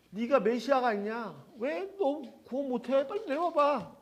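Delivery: scornful crowd taunt, stretched out; mocking jeer. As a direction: jeer up at him, rising into a high mocking challenge. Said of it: "완전히 조롱을 하는 거죠".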